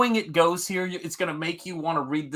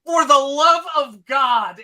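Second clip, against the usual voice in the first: louder, higher, slow exasperated cry; mock exasperation; 8 semitones higher, 7 dB louder, slower than usual. Hero fed up with the timid clerk, hammed-up exasperated cry. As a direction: drag out a hammed-up groan of disbelief.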